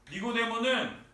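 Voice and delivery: usual speaking voice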